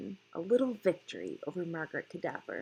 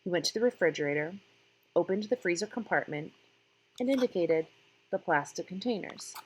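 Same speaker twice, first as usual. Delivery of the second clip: plain reading voice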